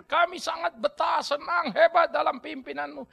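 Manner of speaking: high voice, building to loud protest; Israelites petitioning. Acted. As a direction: play a people complaining to their leader. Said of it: "Orang Israel datang".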